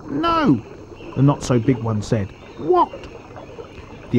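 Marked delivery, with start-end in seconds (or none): 0.1-0.6 high-pitched little crocodile voice; high small-croc voice; not-so-big crocodile. A narrator's reading. 2.6-3.1 high-pitched questioning croc voice; high small-croc question; not-so-big crocodile. A narrator's reading. Smaller crocodile asks in story.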